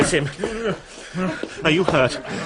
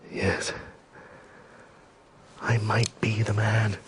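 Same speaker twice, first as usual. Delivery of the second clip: whisper; 2 semitones lower, 6 dB quieter, slower than usual. Acted. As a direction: breathe the words, almost no voice. Pierre resolving to kill Napoleon.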